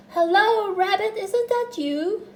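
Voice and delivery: high, loud singsong call; calling hello. A narrator's reading. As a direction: call hopefully into the hole. Pooh calls into the hole.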